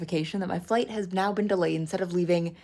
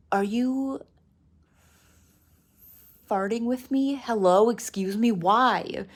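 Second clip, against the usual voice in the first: higher-pitched, long disbelieving pause mid-question; retold incredulous outrage; 4 semitones higher, slower than usual. Traveller reacting to news of another delay, mock incredulous. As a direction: act out exasperated disbelief in pointed questions.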